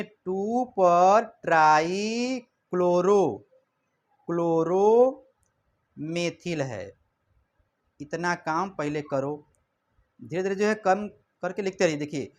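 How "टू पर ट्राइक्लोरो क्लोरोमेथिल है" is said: each part of the name drawn out slowly for students to write; slow dictation. Shown as a demonstration. Teacher dictating a chemical name to the class, slow and drawn out. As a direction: spell out the name at half speed. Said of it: "हम लोग कहेंगे".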